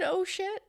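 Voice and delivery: OH SHIT lifted high like a question. Retold or imitated. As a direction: say "Oh, shit" with the pitch climbing sharply. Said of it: "exactly how he said".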